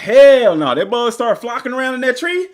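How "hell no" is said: loud retold "hell no"; emphatic refusal. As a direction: bark out a flat refusal, loud. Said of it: "I was like".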